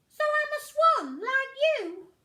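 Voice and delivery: high, loud eager little voice; duckling asking the swan, high and excited; hopeful duckling. Acted. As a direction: ask with growing hope.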